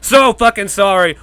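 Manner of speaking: hammed-up sarcastic plea; exaggerated mock apology. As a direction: lay the fake apology on thick. Repeats mock apology, louder.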